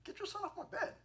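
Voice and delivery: high, snippy mock prophet; mock rude. Preacher joking how Elisha's words sound, mock rude high. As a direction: play it as a cheeky rude order. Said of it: "Sounds like".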